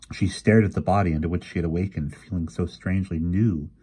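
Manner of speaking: ordinary delivery, nothing marked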